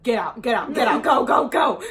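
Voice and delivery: rising mock yell of panic; gut yelling get out. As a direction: inner voice yelling to flee. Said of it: "my gut was like".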